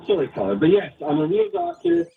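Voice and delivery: ordinary delivery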